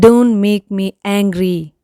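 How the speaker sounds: ordinary talk, nothing marked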